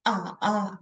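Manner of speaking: dipping third tone ah, ah. Shown as a demonstration. Teacher demonstrating the third tone, dipping. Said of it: "the third".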